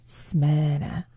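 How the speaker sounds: "Smyrna" low and soft, as if he hated it; disdainful Smyrna. Retold or imitated. Imitating the British newscaster, a low disdainful "Smyrna". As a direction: sneer the word "Smyrna" quietly. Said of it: "sounded like he hated Smyrna".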